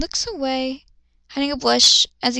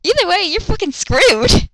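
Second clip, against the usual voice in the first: exasperated; 6 semitones higher, 4 dB louder than usual. Real, not acted. Exasperated comment on the story.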